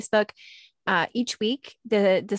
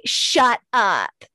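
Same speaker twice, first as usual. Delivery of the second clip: loud, drawn-out incredulous "shut up"; movie-style disbelief; 2 semitones higher, 6 dB louder than usual. Acted. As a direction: say it like an amazed movie character, punching SHUT. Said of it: "like, in movies when they say".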